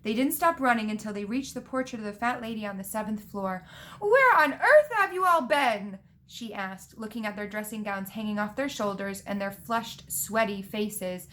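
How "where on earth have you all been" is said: high, loud scolding question; shocked demand. A narrator's reading. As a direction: exclaim it loud and high in disbelief. Portrait scolds returning students.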